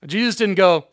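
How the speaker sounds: normal talking voice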